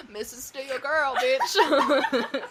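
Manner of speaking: laughing sassy quote. Retold or imitated. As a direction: toss it off laughing.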